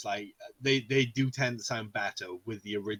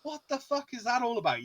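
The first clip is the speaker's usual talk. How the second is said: baffled; 11 semitones higher than usual. Retold or imitated. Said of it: "And you're like".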